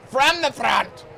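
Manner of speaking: ordinary talk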